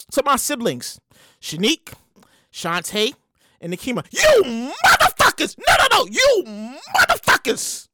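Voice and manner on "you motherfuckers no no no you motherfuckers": comic outrage. Real, not acted. Host cursing out siblings who moved Mother's Day, outraged. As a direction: lean hard on the curse, pitch swinging up.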